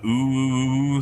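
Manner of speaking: long, wavering spook's "ooh"; ghostly ooh. Shown as a demonstration. Showing how ghosts speak in media, ghostly drawn-out ooh. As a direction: draw out the ooh like a movie ghost. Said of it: "when a ghost speaks".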